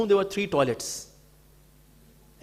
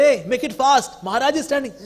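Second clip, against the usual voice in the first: louder, higher urging call; 8 semitones higher, 7 dB louder than usual. Retold or imitated. Devotees hurrying the person in the toilet, calling out loud. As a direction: hurry whoever is inside.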